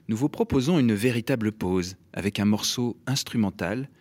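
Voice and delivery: ordinary delivery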